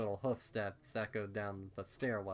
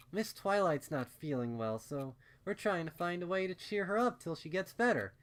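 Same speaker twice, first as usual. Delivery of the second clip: higher, louder mother voice, lightly reassuring; explaining gently; 7 semitones higher, 4 dB louder than usual. A narrator's reading. Mother explaining to her daughter, higher and reassuring. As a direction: explain it kindly to a small child. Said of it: "she explained".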